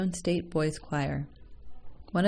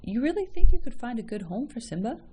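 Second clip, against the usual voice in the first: higher and louder, genuinely surprised; 6 semitones higher, 7 dB louder than usual. A narrator's reading. Father to the mother, surprised she could rehome Simba. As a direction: catch him off guard with hope. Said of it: "The man said in a surprised voice".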